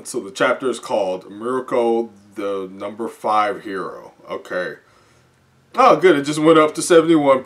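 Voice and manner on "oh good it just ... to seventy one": bursts out louder and higher; suddenly thrilled; excited relief. Real, not acted. Relieved when the meter rises.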